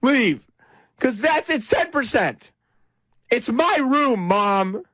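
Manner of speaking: put-on high-pitched bratty teenager; mock a teen throwing a tantrum at a parent; Gary Johnson. Host casts candidate as a kid arguing with Mom, teenage tantrum. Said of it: "acting like a teenager".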